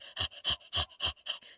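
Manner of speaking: fast, voiceless panting; huffing breaths in mimicry of a dog; imitated dog panting. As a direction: pant like a hot, tired dog. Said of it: "he'd come back and be like".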